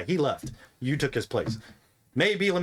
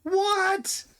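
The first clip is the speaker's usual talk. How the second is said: What much higher and drawn out; shocked what. Acted. Masked mimic hearing the three, a high shocked what. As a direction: react like the score is an outrage.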